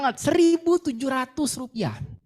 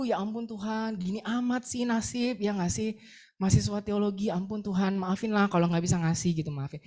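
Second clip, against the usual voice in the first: softer, mock-weepy plea to God; tearful quiet prayer; 5 semitones lower, 6 dB quieter than usual. Ashamed student praying in the bathroom, softly weepy. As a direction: murmur the apology as if holding back tears. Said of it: "Nangis gitu, malu".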